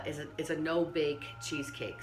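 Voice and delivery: ordinary delivery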